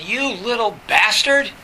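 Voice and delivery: retelling a furious advisor's name-calling; furious insult. Retold or imitated. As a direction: spit the insult out slowly and loud. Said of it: "he was furious".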